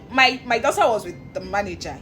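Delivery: ordinary talk, nothing marked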